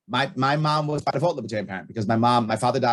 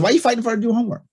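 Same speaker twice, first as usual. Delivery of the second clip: higher, louder and fast, half-incredulous; raised and rushed, imitating his own frustration; exasperated retold question; 10 semitones higher, 5 dB louder, much faster than usual. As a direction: fire the question back at her quickly. Retelling a fight over homework.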